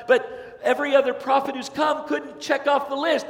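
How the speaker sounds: ordinary delivery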